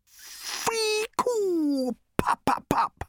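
high squeal then breathy pops; game sound effects. Acted. Zaphod making game noises.

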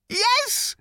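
high, startled yelp. A narrator's reading. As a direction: squeak it out in alarm. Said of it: "yelped Arthur".

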